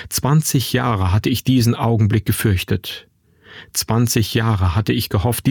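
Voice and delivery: ordinary delivery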